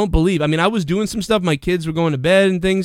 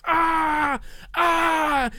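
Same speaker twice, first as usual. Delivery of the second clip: mock scream; 10 semitones higher, 4 dB quieter than usual. Wrestler yelping in pain after a tackle, mock scream. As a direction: yelp like a hurt wrestler. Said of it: "and he's screaming".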